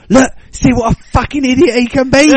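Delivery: high, loud mock shout at his mates; mum shouting. Mum shouting at his mates, high and loud. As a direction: belt it out like an angry mum. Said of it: "she was just shouting at all of my mates".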